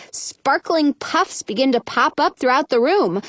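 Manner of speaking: usual speaking voice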